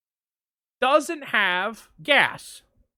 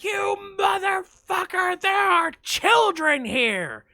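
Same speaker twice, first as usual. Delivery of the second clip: high, slowed mock shout through a bullhorn; retold bullhorn scream; 9 semitones higher, slower than usual. Bullhorn person screaming at the driver, high mock yell. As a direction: yell it like an outraged bystander. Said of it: "fucking screaming at me".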